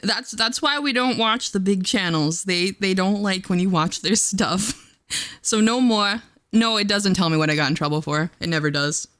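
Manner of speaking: ordinary talk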